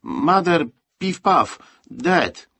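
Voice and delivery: put-on high, loud child's voice; small foreign boy miming a story; boy's broken English. A narrator's reading. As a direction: punch out each foreign word separately. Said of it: "posługując się niewielką liczbą słów angielskich".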